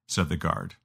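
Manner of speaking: ordinary delivery, nothing marked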